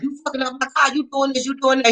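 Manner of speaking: high-pitched mock rant at the mechanic. To her mechanic, blaming him, a high mock rant. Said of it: "I was starting to go off on him".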